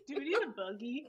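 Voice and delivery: laughing, teasing imitation of a Southern accent; mock country accent. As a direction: tease the friend by copying her Southern accent. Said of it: "I just get a really hard country accent".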